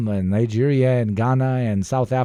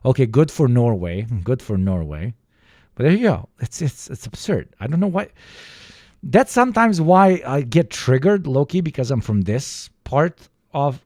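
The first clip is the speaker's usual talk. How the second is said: normal talking voice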